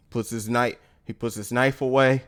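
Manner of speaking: normal talking voice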